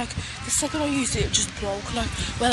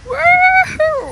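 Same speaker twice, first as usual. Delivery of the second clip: cheering; 11 dB louder than usual. Real, not acted. Cheering in the waves.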